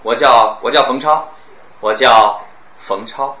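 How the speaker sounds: slow 我叫冯超 with a pause before the name; a slow, deliberate pause demo. Shown as a demonstration. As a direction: drag it out slowly, pausing before 冯超. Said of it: "你说一个停顿".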